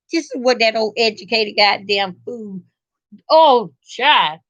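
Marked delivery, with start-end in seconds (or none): none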